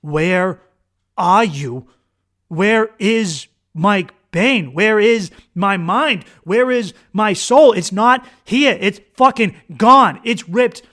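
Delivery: anguished, drawn-out shouting in retelling; brain crying out. Retold or imitated. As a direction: call out word by word for a lost self. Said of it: "my brain is like".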